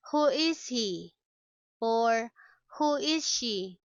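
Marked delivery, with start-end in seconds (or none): none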